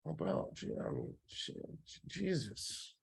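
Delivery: very soft, stunned mumbling; overwhelmed and paralysed. Acted. Trainer playing a newcomer swamped by information, acted stunned paralysis. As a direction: let the words die away as if dumbstruck. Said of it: "witness me get paralysis".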